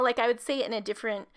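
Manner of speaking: normal talking voice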